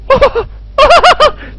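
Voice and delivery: very high nervous laughing burst; panicky laughter. Real, not acted. Player just after a scare, panicky high laughter. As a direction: laugh wildly, right up high.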